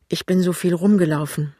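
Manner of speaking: plain reading voice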